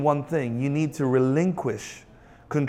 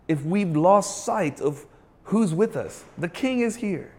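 ordinary talk, nothing marked

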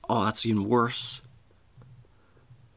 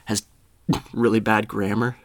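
ordinary talk

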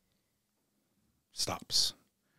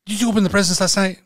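high, rapid, raised mock-mother interrogation; scolding mom; 7 semitones higher, 6 dB louder, faster than usual. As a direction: imitate a mother grilling her child. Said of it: "my mom's like".

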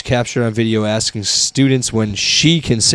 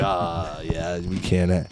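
mock deflated parent; 5 semitones lower, 12 dB quieter, much slower than usual. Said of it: "And they were like".

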